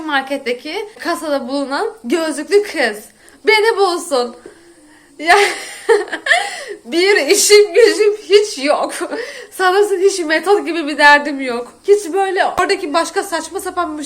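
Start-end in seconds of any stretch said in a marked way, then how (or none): 5.2-8.9 slow, laughing, louder sarcasm; real amused exasperation; laughing disbelief. Real, not acted. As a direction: laugh through the sarcastic complaint. Laughing at absurd situation.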